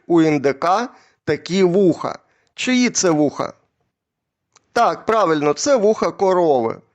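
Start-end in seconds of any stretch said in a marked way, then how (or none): none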